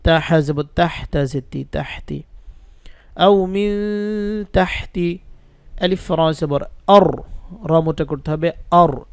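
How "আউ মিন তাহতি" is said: drawn-out Quran-style reading, slow; slow recitation. Shown as a demonstration. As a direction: chant আউ মিন তাহতি slowly. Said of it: "teacher reads joined word". (ধীর তিলাওয়াত)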